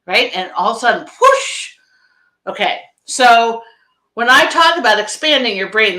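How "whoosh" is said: a high, swooping whoosh; whoosh sound. Retold or imitated. Coach showing the sudden shift, playful whoosh. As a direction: mimic something zooming past.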